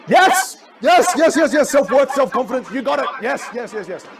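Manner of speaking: high, loud yeses, gradually easing; jubilant approval. Real, not acted. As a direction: burst out cheering the right answer. Chat gives the answer sought.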